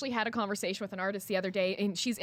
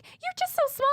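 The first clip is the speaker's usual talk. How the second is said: put-on much higher, cooing baby talk; talking to a toddler. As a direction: coo at her like a small child. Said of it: "they're like".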